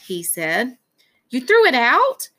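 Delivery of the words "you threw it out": higher, louder incredulous question; mad disbelief. A narrator's reading. Son learns dad tossed his helmet, angry disbelief. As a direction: throw it back as an outraged question. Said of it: "saw how mad I was".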